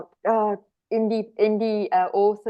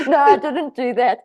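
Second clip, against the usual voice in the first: laughing; 3 semitones higher, 5 dB louder than usual. Real, not acted. Laughs at German accent joke.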